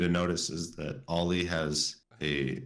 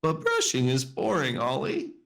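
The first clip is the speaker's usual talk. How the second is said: higher whiny monster voice; skeptical Fuzzy; 9 semitones higher, 4 dB louder than usual. Acted. Fuzzy complains to Ollie, higher. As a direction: whine it like a bored kid monster. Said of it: "So Fuzzy was skeptical".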